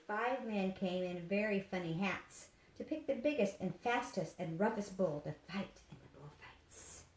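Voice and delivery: usual speaking voice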